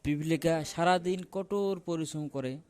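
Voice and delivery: plain reading voice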